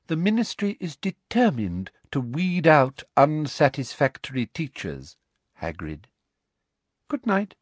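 high, sickly sweet and menacing; a prim high threat; Umbridge voice. A narrator's reading.

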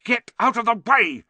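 high, loud, angry outburst; snapped. Acted. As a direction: snap at a pest blocking you. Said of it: "snapped Ron".

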